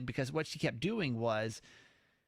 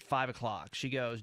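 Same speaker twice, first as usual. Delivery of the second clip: ordinary talk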